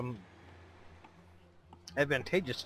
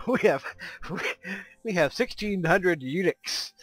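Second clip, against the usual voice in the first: laughing; 6 semitones higher, 6 dB louder than usual. Real, not acted. Laughing at his slip of tongue.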